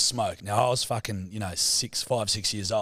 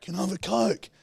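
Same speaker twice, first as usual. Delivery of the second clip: put-on kid asking; 10 semitones higher than usual. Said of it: "I'm like".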